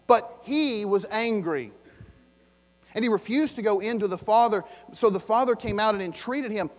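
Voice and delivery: loud, building scripture read; preaching at full force. Acted. Pastor reading the angry son's reply, loud.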